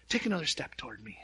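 quick, tender whisper; hushed gentle coaxing; whispered invitation. Retold or imitated. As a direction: whisper the child forward. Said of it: "He's like".

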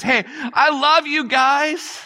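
tender reassurance. Acted. God gently opening with love. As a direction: reach out tenderly and slowly.

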